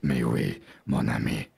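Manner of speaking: put-on very low voice with a French accent; foreign accent. Bluffing traveler assures guard, deep French accent. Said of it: "where his accent is from".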